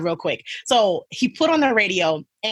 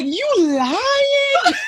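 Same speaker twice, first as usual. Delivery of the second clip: very high, louder, slowed laughing shriek; 4 dB louder than usual. Retold or imitated. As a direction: squeal through laughter in disbelief. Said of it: "And I'm like".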